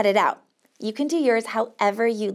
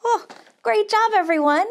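excited praise; 9 semitones higher than usual. Real, not acted. Cheering kids after strumming.

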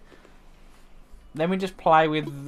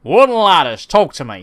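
loud mock echo; 9 dB louder than usual. Echoing friend's joke line loudly.

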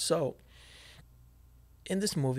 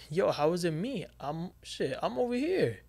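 high-pitched, incredulous defence; retold protest; 6 semitones higher than usual. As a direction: voice climbing sharply as the accused man. Accused man protests in retelling.

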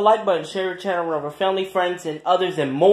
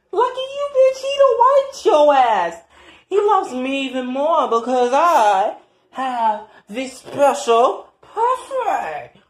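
high, loud mocking gloat; taunting rival; 10 semitones higher, 5 dB louder than usual. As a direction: gloat over the other woman.

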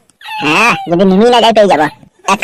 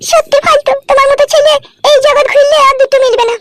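much higher female voice, admiring; wife character. Acted. Wife praising husband's new shirt, high female voice. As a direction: play the doting wife, high-pitched.

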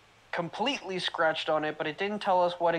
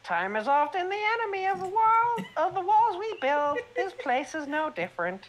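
put-on old man voice; 12 semitones higher than usual. Old man answers about room.

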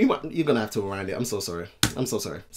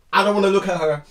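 normal talking voice

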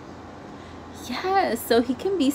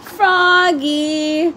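father calls his son, raised and stretched; calling out; 6 semitones higher, 4 dB louder than usual. A narrator's reading. Father calling Froggy to get going, calling out. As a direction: call him from the next room. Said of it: "called his father".

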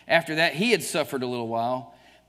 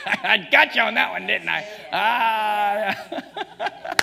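laughing, louder playful jab; laughing tease; 6 semitones higher, 6 dB louder, much slower than usual. Real, not acted. Preacher teasing his congregation, laughing loudly. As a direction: tease them through a real laugh.

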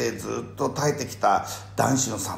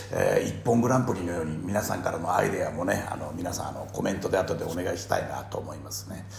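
ordinary delivery

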